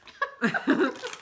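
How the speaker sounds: bursting laugh at a joke; real laugh. Real, not acted. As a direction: laugh out loud, high.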